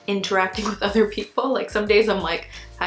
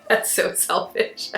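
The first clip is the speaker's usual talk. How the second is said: laughing; 5 semitones higher, slower than usual. Real, not acted. Laughs at own joke.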